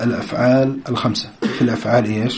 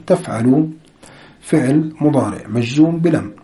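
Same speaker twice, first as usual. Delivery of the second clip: ordinary delivery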